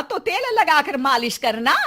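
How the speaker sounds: ordinary talk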